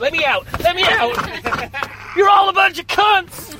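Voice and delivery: loud, high-pitched mock shout; panicked outburst. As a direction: yell to escape, hammed-up and building. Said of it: "And then he goes".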